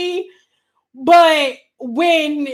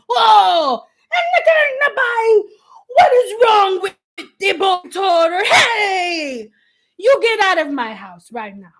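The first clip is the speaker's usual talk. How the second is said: mock outraged mother; 5 semitones higher than usual. Stylist repeating the client's story, hammed-up yelling. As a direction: yell like a furious mother catching her daughter. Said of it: "that's just how it sounded".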